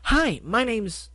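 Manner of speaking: high, slow, both voices at once; two excited ponies greeting in sync; speaking in unison. Acted. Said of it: "as they spoke in stereo".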